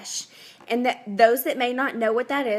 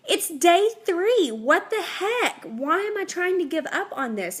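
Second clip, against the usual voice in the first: exasperated customer; 6 semitones higher than usual. Retold or imitated. Customer quoted, exasperated at herself.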